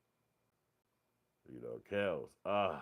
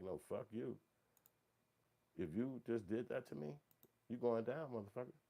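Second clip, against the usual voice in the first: higher pitched, threatening retort; mock angry girlfriend; 7 semitones higher than usual. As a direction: play the scorned girlfriend snapping back. Jilted girlfriend threatens the man.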